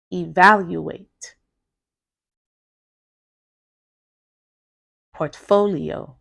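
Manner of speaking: four-syllable words with one punched syllable, obserVAtion, eVALuate, portFOlio; word stress demo. Shown as a demonstration. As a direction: stress Observation, democratic, regulation on the third syllable, evaluate, portfolio, significant on the second. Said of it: "notice which syllables I put the stress in".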